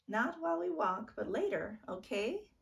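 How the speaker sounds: promising. A narrator's reading. Big sister promising later.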